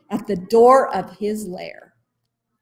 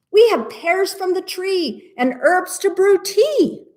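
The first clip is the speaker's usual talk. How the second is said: little bird voices; 10 semitones higher than usual. Acted. Birds speak.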